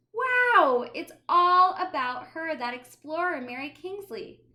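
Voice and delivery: high, loud awe fading down; delighted wonder. Acted. Child thrilled by a signed Mary Kingsley book, awed. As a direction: gasp with delight.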